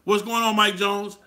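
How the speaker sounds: loud, high, friendly hail to a buddy; a loud, upbeat greeting shouted across the room; hearty greeting. Pretend. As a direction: call out the greeting like spotting a friend. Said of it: "I'ma say it like this".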